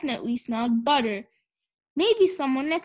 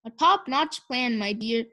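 ordinary delivery

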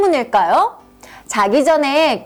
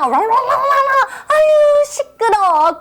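high, drawn-out exasperated scold; mother scolding her son for stomping; irritated mom; 10 semitones higher, much slower than usual. Acted. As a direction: snap at a noisy kid. Mother scolds noisy child.

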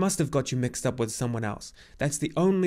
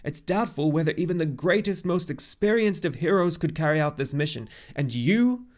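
loud, high, agitated tirade; indignant cry; 6 semitones higher, 5 dB louder than usual. A narrator's reading. Old centaur urging the boy to decide at once, indignant and overwrought. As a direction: press urgently, peaking then tiring slightly. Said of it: "cried Chiron indignantly".

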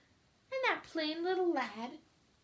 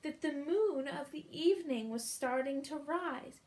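plain reading voice

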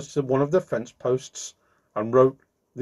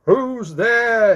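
high, loud, drawn-out holler; shouted call; 10 semitones higher, 7 dB louder than usual. A narrator's reading. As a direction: call out into the darkness. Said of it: "he shouted out of the darkness".

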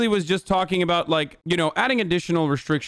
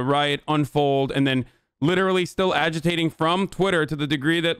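normal talking voice

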